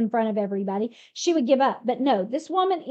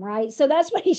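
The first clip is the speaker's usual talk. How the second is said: usual speaking voice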